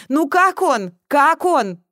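high, loud, anxious call; shouting. A narrator's reading. Mother on first floor asks about patient, shouting. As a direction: shout up for news. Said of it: "крикнула с первого этажа".

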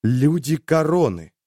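dying man's furious cry; helpless fury. Acted. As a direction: spit it out in powerless rage. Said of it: "с бессильной яростью".

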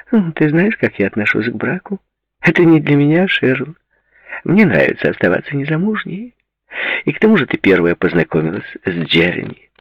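high-pitched, light woman's voice; amused woman friend. Acted. Woman dismissing marriage to her friend, amused and high. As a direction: keep it airy and pitched well up.

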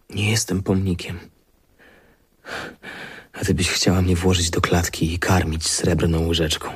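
ordinary talk

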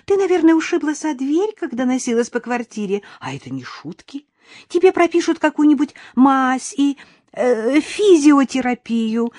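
high, sugary fussing; twittering. A narrator's reading. Mother-in-law bustling Karina out the door, twittering. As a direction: chirp busily, easing down toward the end. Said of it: "снова защебетала Сандра".

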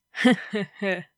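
put-on Stalin chuckle; measured, acted he-he-he laugh; Georgian-accented chuckle. Stalin laughs down the phone, accented chuckle. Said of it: "с грузинским акцентом засмеялась трубка".

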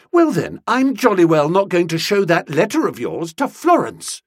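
crying out. A narrator's reading. Young woman threatens to withhold the letter, crying out. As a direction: threaten him with real indignation. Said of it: "cried Nobby".

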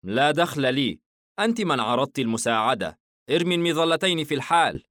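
scowling and impatient with her; grumpy. Acted. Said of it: "بنظرة وجه متكشرة". (حاييم يأمر الفتاة بضيق وسرعة)

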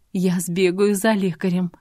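plain reading voice

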